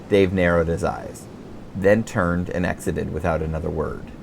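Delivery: ordinary talk, nothing marked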